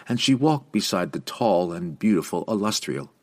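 ordinary delivery

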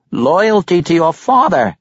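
high, loud admiring exclamation; exclaiming. A narrator's reading. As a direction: exclaim it with approval, pitched right up. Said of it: "Pericolo exclaimed".

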